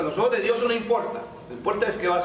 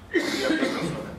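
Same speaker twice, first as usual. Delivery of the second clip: laughing; 8 semitones higher, faster than usual. Retold or imitated. Preacher voicing Moses' excuse.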